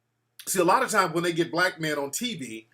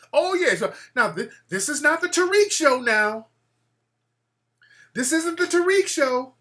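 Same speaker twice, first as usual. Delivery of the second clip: higher, mocking the lady; mock haughty lady; 8 semitones higher than usual. The lady on the panel scolding him on TV, mock haughty. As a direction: mimic her dismissive scolding. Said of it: "she was trying to pretend that I was mad".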